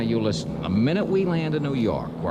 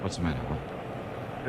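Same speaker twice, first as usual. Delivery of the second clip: hushed whisper; 8 semitones lower, 8 dB quieter, slower than usual. Acted. Johnny suddenly hushed on the plane.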